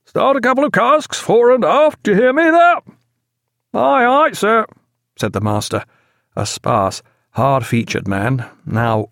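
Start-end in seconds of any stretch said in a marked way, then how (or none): 0.0-2.8 high, loud bellow across the water; shouted order. A narrator's reading. Shouting orders to other ship. 3.7-4.7 high, loud answering call; shouted acknowledgement. A narrator's reading. Master answers shouted order across water.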